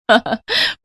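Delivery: a light rising giggle at her expense; short mocking laugh. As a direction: laugh at the bride's plight. Sister-in-law mocks the bride.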